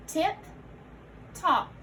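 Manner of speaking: "Tip" and "top" apart, each on its own; a full beat of silence between "Tip" and "top"; split compound. Shown as a demonstration. Teacher modelling compound parts for learners, "Tip", pause, "top".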